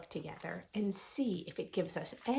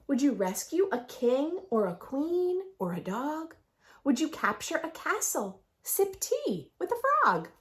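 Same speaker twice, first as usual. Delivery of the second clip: lively sing-song picture book read; reading to children; 3 semitones higher, 4 dB louder than usual. A narrator's reading. Teacher reads the rhyming book to her class. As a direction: read the rhymes aloud with bounce.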